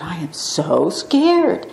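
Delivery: hushed, slow, frightened murmur; slow hushed fear; scared whisper. Retold or imitated. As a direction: breathe it out like a terrified patient. Retold scared patient whispering.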